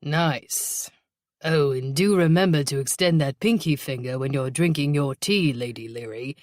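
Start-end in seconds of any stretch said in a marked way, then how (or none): 1.4-6.4 put-on British accent for mock-genteel teasing; fake British accent. Detective teasing his partner about her tea, fake British accent. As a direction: tease in a hammed-up British accent. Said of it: "in his fake British accent".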